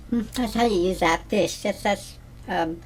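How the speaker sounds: usual speaking voice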